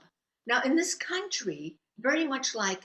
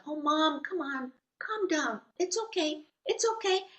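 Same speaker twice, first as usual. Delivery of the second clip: put-on higher-pitched child's plea; pleading daughter; 6 semitones higher than usual. Asia begging her angry mom to calm down, high pleading. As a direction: voice a worried child calming a parent.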